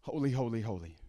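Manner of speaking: low, very soft "Holy, holy, holy", sinking at the end; hushed reverence. Acted. Preacher voicing worship before God, hushed "Holy, holy, holy". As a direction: murmur "Holy, holy, holy" in awe.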